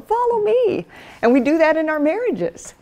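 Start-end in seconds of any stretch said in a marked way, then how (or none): 0.0-0.8 high, gentle follow me; Jesus calling. Retold or imitated. Jesus calling the church to follow, high and gentle. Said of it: "And Jesus going".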